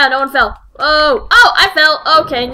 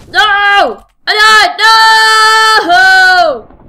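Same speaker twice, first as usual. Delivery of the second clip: higher, loud wail of defeat; anguished shout; 8 semitones higher, 8 dB louder, much slower than usual. Real, not acted. Gamer shouting in dismay at his own death. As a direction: cry out as the round is lost. Dies in the game.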